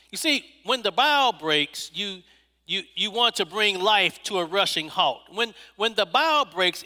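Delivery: ordinary talk, nothing marked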